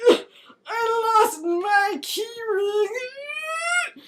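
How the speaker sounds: high, very slow wailing complaint; a hammed-up, high, drawn-out moan; whiny Hodge. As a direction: drag it out in a fake whine. Hodge whining about his keys.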